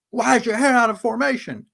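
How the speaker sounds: high, loud, squawky imitation of a gung-ho sergeant; mocking sergeant. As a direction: play the petty army zealot barking about hair.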